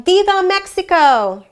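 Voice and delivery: high, loud festive cheer; crowd shout. A narrator's reading. People at the fiesta hail Mexico, shouting. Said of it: "people shouting".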